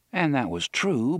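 ordinary delivery, nothing marked

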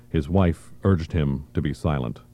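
usual speaking voice

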